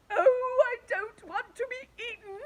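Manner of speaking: high crying wail. A narrator's reading. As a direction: wail in terror. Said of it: "wailed the Earthworm".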